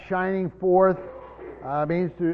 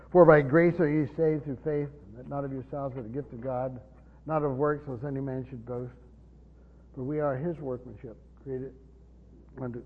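lower, softer, reverent reading of Scripture; scripture recitation; 7 semitones lower, 6 dB quieter than usual. Acted. Preacher reciting Ephesians to the congregation, hushed and reverent. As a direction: recite the verse quietly as holy words.

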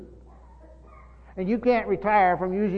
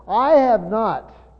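slow, louder stress on "I have not"; no uncertain terms; 3 semitones higher, 6 dB louder, much slower than usual. Retold or imitated. Preacher quoting Paul's denial to the congregation, slow and emphatic. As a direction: quote "I have not" emphatically, each word weighted. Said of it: "in no uncertain times".

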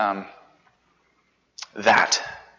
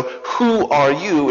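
slow and loud, WHO stressed high; the key question, slow and loud; emphatic question; 7 semitones higher, 7 dB louder, much slower than usual. Real, not acted. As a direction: lean hard on "Who", drawn out. Repeats the question with force.

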